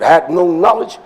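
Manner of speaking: usual speaking voice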